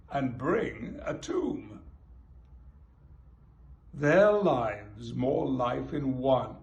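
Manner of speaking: slow, measured sonnet reading; reciting a sonnet. Acted. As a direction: read it aloud like a poem. Reads a Shakespeare sonnet aloud.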